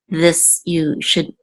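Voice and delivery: ordinary delivery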